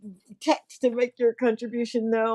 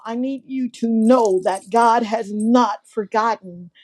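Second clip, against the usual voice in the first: ordinary talk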